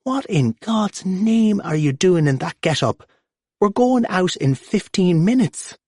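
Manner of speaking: high scolding mam voice; mother yelling. Acted. Mam scolds P J for his get-up, high and exasperated. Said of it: "Mam appears and starts yelling".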